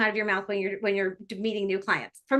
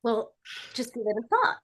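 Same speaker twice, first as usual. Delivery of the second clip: usual speaking voice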